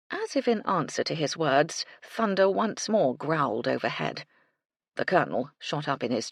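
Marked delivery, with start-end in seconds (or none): none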